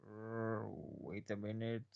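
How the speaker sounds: soft, slowed dawning thought; quiet realisation. Acted. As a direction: hold back as it dawns. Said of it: "has a thought and goes".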